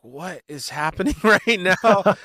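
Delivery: slow, high-pitched mock bewilderment breaking into a laugh; amazed disbelief. Bandmate recalling everyone looking at each other, amazed and laughing. Retelling the band's shared astonishment, laughing.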